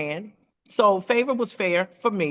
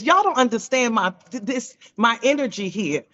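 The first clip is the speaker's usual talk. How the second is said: ordinary delivery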